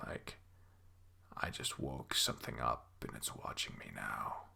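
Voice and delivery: hushed, very low whisper; fearful whisper. A narrator's reading. Narrator senses something woke up and watches, fearful whisper. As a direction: barely breathe it out, afraid of being heard.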